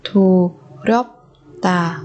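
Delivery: "Thù rớp tà" with pauses between syllables. Shown as a demonstration. Teacher modelling a word for learners, syllable by syllable. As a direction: break "Thù, rớp, tà" apart.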